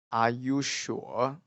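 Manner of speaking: "Are you sure?" slowly, "sure" dipping then rising; drawn-out doubtful lift on "sure"; third-tone tune. Shown as a demonstration. Teacher cues the third tone, slow questioning tune. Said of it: "the third tone".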